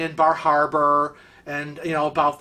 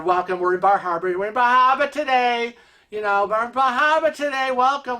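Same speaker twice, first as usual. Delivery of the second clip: chirpy crew announcement; 6 semitones higher, faster than usual. Retold or imitated. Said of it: "and they're".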